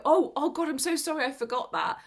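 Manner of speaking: hammed-up panicky apology; mock flustered. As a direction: act out a fluster over a slip. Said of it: "Instead of getting flustered and go".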